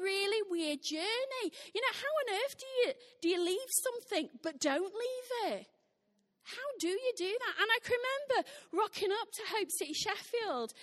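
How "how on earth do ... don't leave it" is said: ordinary talk, nothing marked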